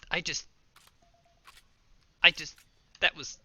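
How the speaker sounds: loud, thrilled and stammering; excited. Real, not acted. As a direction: shout it out, amazed at yourself. Thrilled by own shot.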